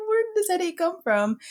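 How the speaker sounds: high, exasperated question; annoyed disbelief. Retold or imitated. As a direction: squeak it out, annoyed. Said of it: "I was like".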